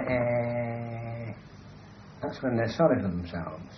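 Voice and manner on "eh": small whimpering eh sound; baby cry demo. Shown as a demonstration. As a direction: mimic a self-pitying baby cry. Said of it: "When babies cry".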